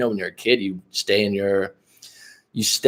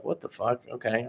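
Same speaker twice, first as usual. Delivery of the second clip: soft, private inner-thought voice; quiet aside to self; thinking to himself; 10 dB quieter than usual. Retold or imitated. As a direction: say it under your breath, as if to yourself. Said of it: "thinking to myself".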